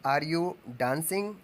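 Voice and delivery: very slow, word by word for the class; slow dictation. Shown as a demonstration. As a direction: say it slowly so students can write. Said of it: "क्या तुम नाच रहे हो?". (धीरे-धीरे वाक्य बोलना)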